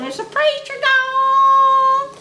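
very high, slow, loud crow; rooster crow. Retold or imitated. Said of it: "like a rooster crowing".

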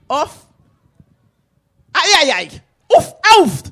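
high, loud bossy auntie barking the order; bossy auntie demand. Acted.

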